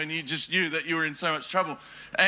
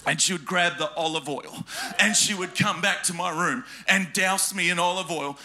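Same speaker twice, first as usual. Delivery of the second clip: ordinary delivery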